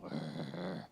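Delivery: low, quiet rumbling growl. Retold or imitated. Catching a friend's eye down the school hallway, low growl. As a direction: growl it low as if starting a war cry. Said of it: "look at each other and go".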